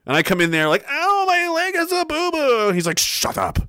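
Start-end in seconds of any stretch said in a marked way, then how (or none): 0.9-2.7 put-on high, babyish whimper; mock whiny baby. Soldier mocks himself whining to the doctor, babyish. 3.0-3.6 curt doctor. Retold or imitated. Doctor dismissing him.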